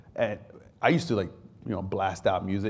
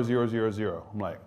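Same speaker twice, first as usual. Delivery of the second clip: ordinary talk, nothing marked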